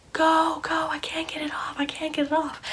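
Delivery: high, thin imitation of panic; mock panicked plea. Mom trapped, urging her child to go, mock panic. Mom urges escape, retold.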